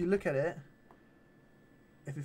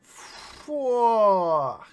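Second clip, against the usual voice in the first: loud, long-drawn exclamation of awe; amazed swear; 3 semitones higher, 8 dB louder than usual. Retold or imitated. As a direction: draw it out loudly, blown away. Retold reaction to trying them on.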